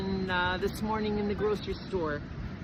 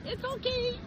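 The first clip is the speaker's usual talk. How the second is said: very high, playful sing-out of a quote; high-pitched retold reply; 12 semitones higher than usual. Speaker answering the laughing cashier, high retold reassurance.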